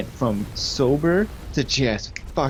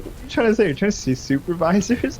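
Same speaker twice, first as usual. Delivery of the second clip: high, puzzled voice; bewildered high-pitched question; baffled reply; 8 semitones higher than usual. Retold or imitated. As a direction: squeak it out in confusion. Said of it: "and I'm like".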